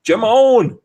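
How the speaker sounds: high, loud cheerleading plea; urging call-out. Real, not acted. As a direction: egg the audience on, loudly. Host urging viewers to hit thumbs up.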